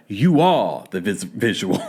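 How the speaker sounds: lightly mimicked star, drawn out; slow, pointed impression cracking into a laugh. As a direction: stretch it out like a lightly imitated diva. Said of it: "She's gonna be like".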